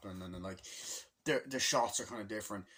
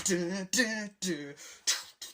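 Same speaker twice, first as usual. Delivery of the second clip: ordinary talk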